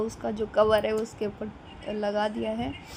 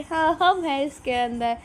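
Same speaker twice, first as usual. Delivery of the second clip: lightly voiced kids answering back, a bit higher; retold children's reply; 4 semitones higher, 4 dB louder than usual. Kids answering mother from inside the tent, lightly imitated higher voice. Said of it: "बोल रहे".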